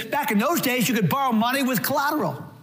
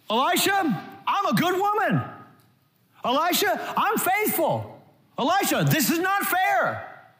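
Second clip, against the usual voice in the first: high, pleading complaint, voice raised; whiny pleading woman; 7 semitones higher than usual. Pretend. Woman complaining to Elisha about her unfair lot, high whiny plea. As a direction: whine at the prophet like you're owed. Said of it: "The woman does not say".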